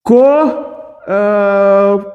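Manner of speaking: ordinary delivery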